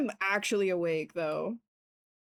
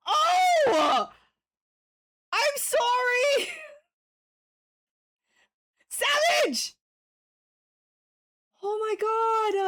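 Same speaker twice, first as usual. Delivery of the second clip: high-pitched screamed outcry; real startled yelling; 12 semitones higher, 4 dB louder, much slower than usual. Real, not acted. Streamer apologising mid-panic after a sudden shock, high yelling. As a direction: yell it way up high, still shocked. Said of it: "I'm sorry for yelling".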